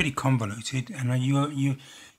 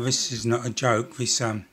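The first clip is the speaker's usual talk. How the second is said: usual speaking voice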